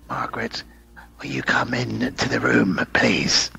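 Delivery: soft, breath-heavy eerie summons, fading to whisper; imitating creepy host. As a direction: invite her in softly, creepily. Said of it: "He then says".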